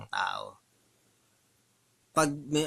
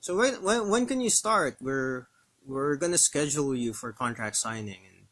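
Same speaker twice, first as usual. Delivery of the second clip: put-on interviewer's lines in an American accent with no Filipino accent. Vlogger imitates an American-accented interviewer hiring on the spot. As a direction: sound fully American, no trace of Filipino accent. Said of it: "Pag walang Filipino accent".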